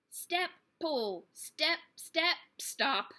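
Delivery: straining effort. A narrator's reading. Flora straining at the chain.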